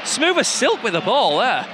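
usual speaking voice

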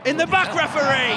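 high-pitched shout at the referee; shouted heckle. Real, not acted. Football fan yelling at the referee, shouted heckle. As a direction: call it out across the pitch.

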